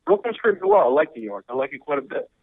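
ordinary delivery

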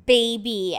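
usual speaking voice

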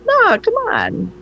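high, drawn-out protest; mock protest. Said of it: "Huber's like".

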